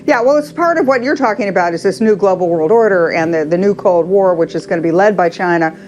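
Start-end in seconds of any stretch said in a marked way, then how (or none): none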